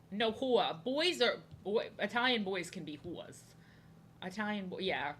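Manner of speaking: ordinary talk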